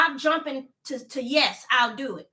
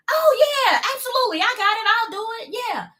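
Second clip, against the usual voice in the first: imitating her old quick yes, pitched up; eager instant yes; 8 semitones higher than usual. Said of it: "I used to come off the cuff with it".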